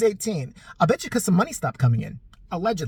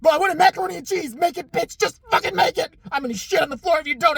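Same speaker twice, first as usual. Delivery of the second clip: hammed-up unhinged home invader yelling at the couple; ranting intruder; 8 semitones higher than usual. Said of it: "to break into someone's house and be".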